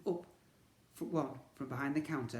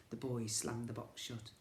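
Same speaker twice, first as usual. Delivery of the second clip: ordinary delivery, nothing marked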